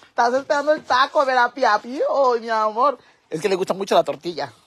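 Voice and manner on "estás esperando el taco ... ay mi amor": high, loud doting coo; excited, affectionate talk to an animal; cooing pet talk. Real, not acted. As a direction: gush at a pet with raised pitch. Teasing fond talk to pet.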